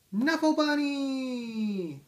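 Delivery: high, loud and slow, drawn-out cry of joy; joyful discovery. Acted. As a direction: stretch it out in delight. Bunny found, joyful exclamation.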